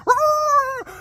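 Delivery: high, loud drawn-out howl; piercing mock howl; imitated dog scream. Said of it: "the more he screams 'cause he's like".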